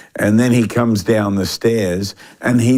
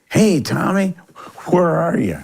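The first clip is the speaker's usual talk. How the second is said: high-pitched East Tennessee greeting; East Tennessee accent; 9 semitones higher than usual. Retold or imitated. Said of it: "with that East Tennessee accent".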